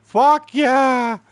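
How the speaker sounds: high, drawn-out triumphant yell; elated cheer. Retold or imitated. Kerry's eyes lit up, loud mock-elated yell. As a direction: whoop it loud, acting out his joy. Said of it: "Kerry's eyes lit up like".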